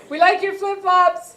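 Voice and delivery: talking to a stranger; grandma's English. Retold or imitated. Grandmother to passerby.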